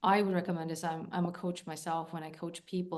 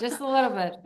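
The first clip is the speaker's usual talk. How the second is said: laughing through the words, higher and louder; 8 semitones higher, 13 dB louder, faster than usual. Real, not acted. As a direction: giggle it out teasingly. Teasing about psycho type.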